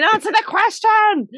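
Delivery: exasperated plea. Retold or imitated. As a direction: plead it in frustration. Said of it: "You're thinking".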